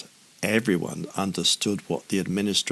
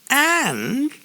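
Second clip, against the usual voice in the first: high snarling administrator; a light mock snarl of a sly official; 5 dB louder than usual. A narrator's reading. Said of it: "snarled the other administrator".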